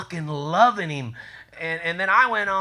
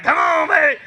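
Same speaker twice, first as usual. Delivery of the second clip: eager shout; 8 semitones higher, 5 dB louder than usual. Retold or imitated. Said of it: "I was like".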